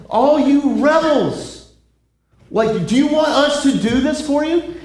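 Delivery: hammed-up insults at a grumbling crowd; ranting Moses. Moses scolding again.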